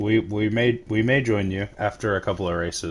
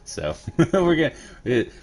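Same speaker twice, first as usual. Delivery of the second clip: laughing; 10 semitones higher than usual. Real, not acted. Laughing at own fix.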